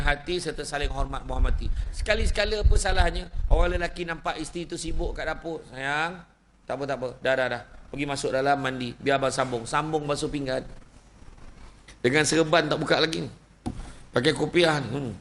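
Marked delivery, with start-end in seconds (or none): 5.7-9.6 tender husband. Acted. Husband kindly offering to help.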